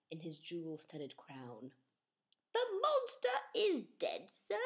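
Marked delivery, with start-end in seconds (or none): 2.5-4.6 a lightly voiced polite young knight; higher, polite boy knight. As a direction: report it courteously. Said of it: "said Kevin politely".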